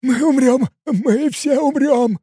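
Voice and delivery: high, drawn-out wail of doom; dawning panic. A narrator's reading. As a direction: whimper in dread as it sinks in. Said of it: "изменился в лице Кен". (обречённо-паническое причитание высоким голосом)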